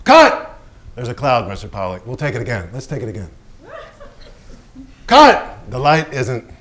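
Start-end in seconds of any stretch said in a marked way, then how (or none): 0.1-0.6 yelled cut, high and loud; furious director yelling cut; director's shout. Acted. Director shouting on film set. 5.1-5.6 yelled cut, high and loud; furious director yelling cut; director's shout. Acted. Director shouting on film set.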